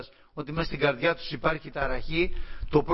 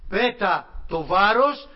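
slow, higher and louder imperative; stern command; 6 semitones higher, 7 dB louder, much slower than usual. Retold or imitated. Heavenly voice commands a third time, stern and slow. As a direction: order it gravely, no argument. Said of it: "σοβαρή και επιτακτική".

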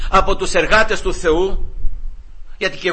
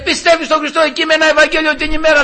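complaining wife; 9 semitones higher than usual. Retold or imitated. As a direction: play the wife pleading to the judge, pitched way up.

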